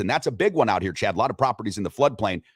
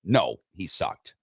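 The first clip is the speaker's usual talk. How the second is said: slow, lower, no, he sucked; slow deadpan result; 5 semitones lower, 3 dB quieter, much slower than usual. Shown as a demonstration. Host announcing poll result to cohost, slow and low. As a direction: savor the winning answer. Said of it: "Seventy thirty".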